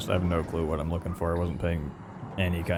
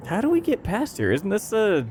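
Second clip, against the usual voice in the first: frustrated; 11 semitones higher, 3 dB louder than usual. Real, not acted. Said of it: "getting frustrated with the game's navigation".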